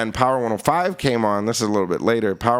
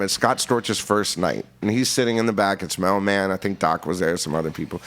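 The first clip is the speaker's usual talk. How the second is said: ordinary talk, nothing marked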